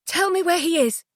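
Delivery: sharp high demand; insist, nothing else matters. Acted. Felicity in Jay's van demanding to know where Leo is. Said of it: "Felicity demanded".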